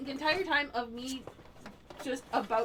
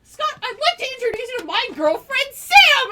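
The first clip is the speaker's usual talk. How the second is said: excited retelling; 11 semitones higher, 13 dB louder than usual. Retold or imitated. Retelling Mike introducing her.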